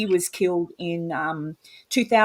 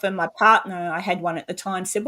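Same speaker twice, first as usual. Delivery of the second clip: usual speaking voice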